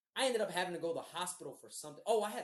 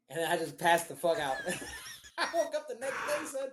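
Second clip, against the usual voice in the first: laughing; 7 dB louder than usual. Real, not acted. Storyteller amused at passing out, laughing as he talks. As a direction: tell it through real laughter.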